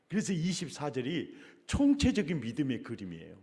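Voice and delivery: usual speaking voice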